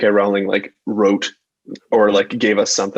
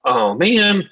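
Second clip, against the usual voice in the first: dismayed; 9 semitones higher than usual. Retold or imitated. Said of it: "I'm like".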